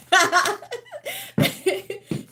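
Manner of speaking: high breathless giggling laugh; out-of-breath cackling laugh. Real, not acted. Laughing after her dance.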